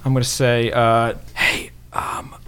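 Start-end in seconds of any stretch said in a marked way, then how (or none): 1.3-2.3 hushed conspiratorial whisper; leaning in to whisper. Acted. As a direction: whisper it close to his ear. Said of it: "So I'm gonna whisper to him".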